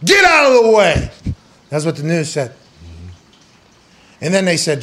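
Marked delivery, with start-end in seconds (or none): 0.0-1.1 high, loud trash-talk snarl; wrestling promo. Acted. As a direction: spit it at a rival. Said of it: "like a wrestling promo".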